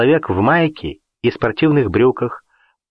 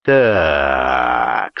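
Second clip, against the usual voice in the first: much lower, ominous growl; sinister voice; 10 semitones lower than usual. A narrator's reading. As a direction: drop into a dark lord growl. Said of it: "совсем уже зловещим голосом произнёс хозяин".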